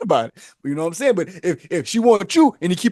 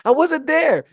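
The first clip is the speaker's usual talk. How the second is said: put-on girlfriend denying; 9 semitones higher, slower than usual. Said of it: "You be like".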